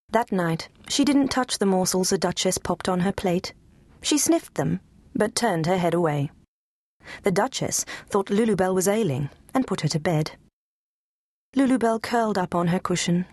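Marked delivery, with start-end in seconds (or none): none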